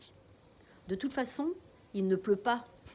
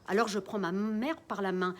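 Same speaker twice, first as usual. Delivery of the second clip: ordinary delivery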